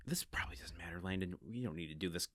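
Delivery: hushed note to self; muttered self-talk. Real, not acted. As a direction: drop right down, as if talking yourself out of it. Talking himself out of research.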